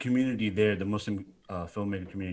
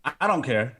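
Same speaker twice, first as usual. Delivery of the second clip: deadpan fake dismissal, a bit higher and louder; mock indifference; 6 semitones higher, 5 dB louder than usual. Guest teasing the host's question, mock indifference. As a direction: pretend not to care as a joke. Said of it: "No, I'm just kidding".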